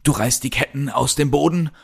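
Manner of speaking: high, sneering taunt; taunting villain. Acted. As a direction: sneer down at the captive. Villain taunts chained hero.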